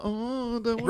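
squeaky mock reluctance; high whiny voice. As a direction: whine it up high like a nervous teacher. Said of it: "They're like".

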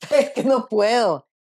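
giggling through the words; fighting back laughter; trying not to laugh. Real, not acted. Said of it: "yo estoy tratando de no reírme". (aguantándose la risa)

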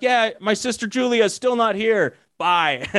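high, mock-oblivious hang-up; clueless brush-off. Receptionist hanging up on the broker, mock oblivious. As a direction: play the confused person dismissing him, lightly. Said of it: "And they're like".

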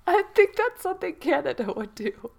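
chuckling through the line; laughing. Real, not acted. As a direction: laugh while you say it. Laughing at the brooms joke.

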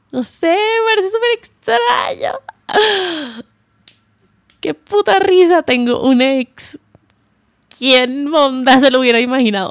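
squealing, high-pitched disbelief; giggly and shocked, way up high; high incredulous laugh. Real, not acted. (voz aguda e incrédula)

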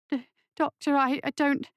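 high, hesitant, faltering voice; nervous stammer. Acted. Anxious nurse objecting to the doctor, stammering. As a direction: stumble timidly over the words.

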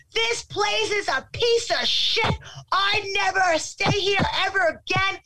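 high-pitched, drawn-out mock outrage; imitating heated customer. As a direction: act out an irate customer storming out. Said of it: "You see how heated he is?".

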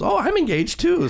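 high, gushing mock voice; mimicking ex-wife. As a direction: squeal it like the ex-wife. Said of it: "She goes".

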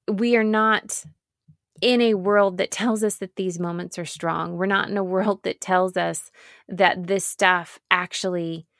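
usual speaking voice

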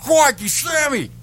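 slow, high shouted Aussie greeting; excited shout. Acted. Croc hunter greets [name], shouted in an Australian accent.

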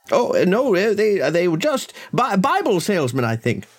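high, faltering butler voice; hesitant butler. Acted. Butler explains the visitors to his master, high hesitant voice. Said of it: "presumably the butler".

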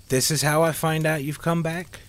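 high, angry, a little sad; hurt accusing demand. A narrator's reading. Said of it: "she said with anger and a slight hint of sadness".

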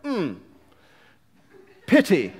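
a hint of a prim, reserved Englishman; stiff British gentleman. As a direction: say it with disgusting propriety. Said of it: "The British man of propriety says".

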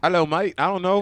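British attempt sliding into Australian, high and loud; hammed-up British greeting that lands Australian; bad British accent. Shown as a demonstration. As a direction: botch a British accent, Aussie-sounding. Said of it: "let's hear that British accent".

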